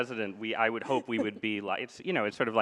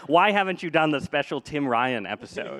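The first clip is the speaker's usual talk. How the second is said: imitated listener complaint; 4 semitones higher, 6 dB louder than usual. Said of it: "people are like".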